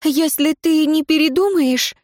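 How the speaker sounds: high, shaky plea; trembling voice. A narrator's reading. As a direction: voice quivering with fear. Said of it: "дрожащим голосом заговорила она".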